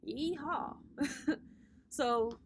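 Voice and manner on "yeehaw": ordinary delivery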